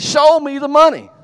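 very loud, high, hollered catchphrase; imitated demanding yell. Rod demands proof from Jerry on the phone, loud yell. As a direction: holler it like a fired-up athlete. Quotes Rod's yell once more.

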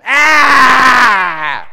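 put-on long loud high wail of a grown baby; mock baby wail. Preacher mimics a grown child crying in church, loud wail. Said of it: "eighteen years old and they're back there going".

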